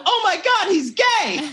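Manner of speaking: loud, high-pitched giddy exclamation; ecstatic, soaring cry; thrilled outburst. Retold or imitated. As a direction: replay the delighted discovery at full pitch. Acts out delighted discovery.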